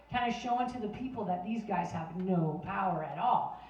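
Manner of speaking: ordinary talk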